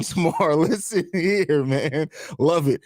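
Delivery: ordinary delivery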